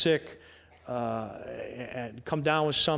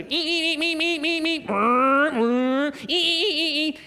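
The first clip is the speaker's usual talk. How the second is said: high-pitched whining beeps and buzzing revs; annoying motorbike imitation; 12 semitones higher, 3 dB louder than usual. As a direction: mimic little motorbikes buzzing past, high and insistent. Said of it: "those annoying little motorbikes".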